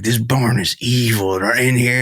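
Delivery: low, slow dramatic pronouncement; mock ominous. Imitating Jeff inside the barn, mock ominous. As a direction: intone it gravely, as a parody. Imitating Jeff's grave verdict.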